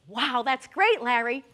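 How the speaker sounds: loud, bright mock cheer; mock enthusiasm. Family cheering Larry's Paris plan, loud mock enthusiasm. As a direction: overdo the delight.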